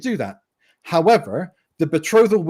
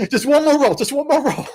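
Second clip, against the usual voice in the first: mock eager player; 11 semitones higher than usual. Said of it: "they'd just be".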